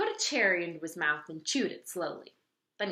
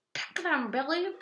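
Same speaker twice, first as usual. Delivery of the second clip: pelican whispering; 9 semitones higher than usual. A narrator's reading. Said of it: "he whispered".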